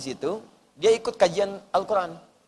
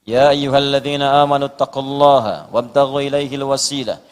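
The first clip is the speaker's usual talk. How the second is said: lower, chanted Arabic verse recitation; Quran recitation; 5 semitones lower, 3 dB louder than usual. Acted.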